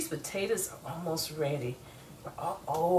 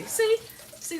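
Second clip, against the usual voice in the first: high bright exclaim; delighted squeal of see; excited see. Real, not acted. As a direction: show it off, thrilled. Showing the ready sausages.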